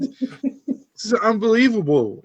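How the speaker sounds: slow, high, drawn-out incredulity; retelling his shock at a racy movie; mock disbelief. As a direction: act out being stunned on the couch.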